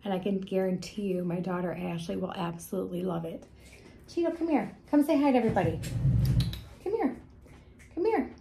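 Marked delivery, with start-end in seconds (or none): 4.1-8.3 high sing-song pet call. Real, not acted. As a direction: coax the cat over, sweetly. Calling the cat over.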